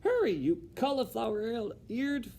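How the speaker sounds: high, loud, slow insult; Cook shouting. Acted. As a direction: bellow the insult. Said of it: "shouted Cook".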